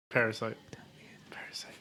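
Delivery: hushed dramatic whisper. Acted. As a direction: breathe the title like a spooky intro. Dramatic whispered show intro.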